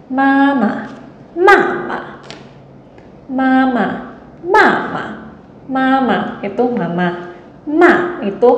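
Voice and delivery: each "mama" on a different tone, rising high and falling; Mandarin tones demo. Shown as a demonstration. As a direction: sing out each "mama" with its own tone. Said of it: "saya bacakan satu kalimat ini ya". (contoh nada Mandarin pada tiap "mama")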